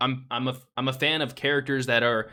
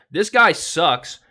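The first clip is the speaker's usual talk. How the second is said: emphatic slow rant; 5 semitones higher, 8 dB louder, much slower than usual. Real, not acted. Ranting about a disliked character.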